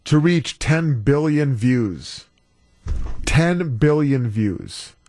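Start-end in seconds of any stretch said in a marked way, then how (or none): 3.2-4.9 TEN drawn out slowly, evenly pitched; slow emphasis. Real, not acted. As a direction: say "Ten billion views" slowly and deliberately. Repeats the record figure for emphasis.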